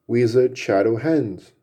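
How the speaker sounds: plain reading voice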